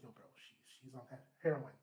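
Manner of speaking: hushed, breathy whisper about the heroin; whispered secret. Retold or imitated. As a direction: whisper it like a secret you shouldn't tell. Manager secretly reports drug use.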